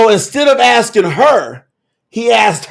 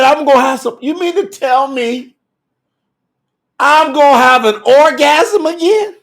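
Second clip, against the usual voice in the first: higher-pitched, incredulous retold question; mock disbelief; 7 semitones higher than usual. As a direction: act out an astonished old wife. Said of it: "This is really what she's saying".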